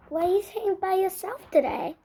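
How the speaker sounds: normal talking voice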